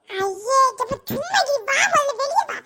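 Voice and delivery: ordinary talk, nothing marked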